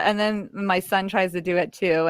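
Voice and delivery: ordinary talk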